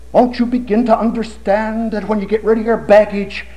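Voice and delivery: high, urgent sermon voice; preaching at full force. Acted. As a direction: preach it with fire.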